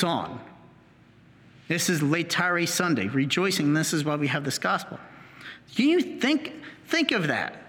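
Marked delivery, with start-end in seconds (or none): none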